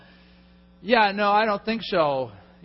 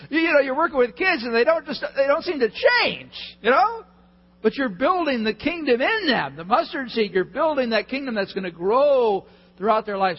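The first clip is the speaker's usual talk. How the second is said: higher and louder, rising passion; fired-up encouragement; 7 semitones higher, 5 dB louder than usual. Real, not acted. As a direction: lift them up with fervour. Encouraging volunteers who teach kids.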